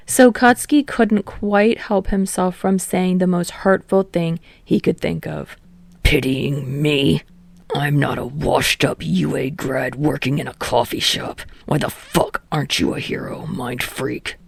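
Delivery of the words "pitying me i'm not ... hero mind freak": low, malicious snap; snapped. A narrator's reading. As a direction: spit the insult out with malice. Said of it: "snapped the words out with as much malice".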